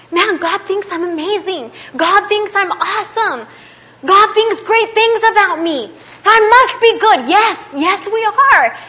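high, loud, building exhortation; fired-up preaching. Real, not acted. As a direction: preach it with rising force. Preaches at full force.

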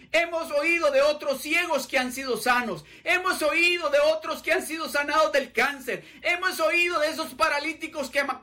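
rising, high urgent urging; urging each other. Retold or imitated. One blind man urging the other to cry out, high and urgent. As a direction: egg your friend on to shout. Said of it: "el uno al otro decía".

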